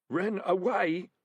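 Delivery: a highwayman's hoarse, fearful mutter. A narrator's reading. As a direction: croak it out, shaken. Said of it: "said the highwayman hoarsely".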